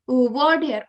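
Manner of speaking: ordinary talk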